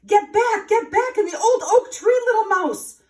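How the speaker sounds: high, loud alarmed cry; urgent warning call. A narrator's reading. Blue jay warns the mouse to hide, loud urgent call. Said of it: "called the jay".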